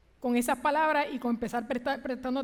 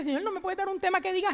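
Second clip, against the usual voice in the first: higher, quicker desperate plea; tearful pleading; 7 semitones higher, faster than usual. Retold or imitated. Preacher recalling a tearful prayer for an easier theme, pleading higher. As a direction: ask God for an easier sermon, near tears. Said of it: "yo con Él llorando".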